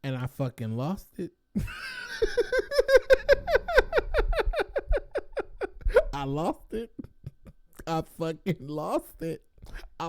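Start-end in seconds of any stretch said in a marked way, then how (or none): 1.5-6.1 explosive high shrieking laugh; laughing shout at the absurd rum offer; wild laughing shout. Real, not acted. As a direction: burst out in a screaming laugh. He loses it.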